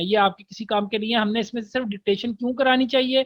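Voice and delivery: ordinary delivery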